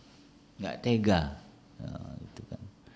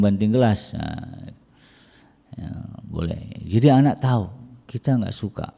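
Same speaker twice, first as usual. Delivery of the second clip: ordinary talk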